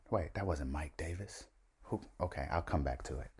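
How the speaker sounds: low, soft aside; rambling to himself. Real, not acted. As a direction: think aloud quietly, as if nobody is listening. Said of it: "see how I ramble by myself".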